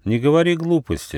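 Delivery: high, mildly cross rebuke. A narrator's reading. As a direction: scold with a touch of anger. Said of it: "рассердился Нюфа".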